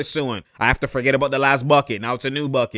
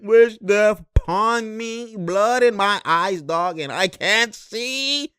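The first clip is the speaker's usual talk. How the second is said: slow, higher, hammed-up suffering; mock dramatic lament; 5 semitones higher, much slower than usual. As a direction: play up the pain slowly. Mocking the poked player.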